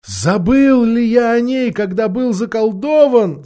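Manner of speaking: slow, high, wondering question; musing to oneself. A narrator's reading. Freed knight questioning himself about Narnia, high musing. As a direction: ask it of yourself in wonder. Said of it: "сказал сам себе рыцарь".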